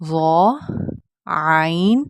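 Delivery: ظا heavy, عین from the throat, both long; stretched Arabic letters ظا, عین; model letter pronunciation. Shown as a demonstration. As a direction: draw out ظا and عین carefully. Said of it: "کس طرح سے ادا کیا جاتا ہے".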